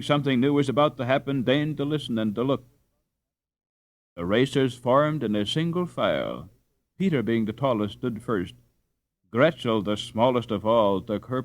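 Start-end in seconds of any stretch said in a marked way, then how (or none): none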